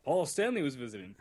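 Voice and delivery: usual speaking voice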